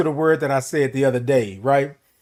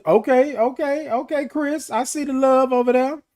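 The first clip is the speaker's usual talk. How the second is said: excited; 9 semitones higher than usual. Real, not acted. Excited reaction to comments.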